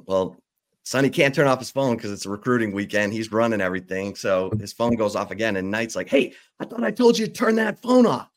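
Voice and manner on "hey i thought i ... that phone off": sharp high-pitched scold, louder; irritated Knight scolding. Retold or imitated. Knight scolding an assistant whose phone rings again, irritated. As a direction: snap at him to kill the phone.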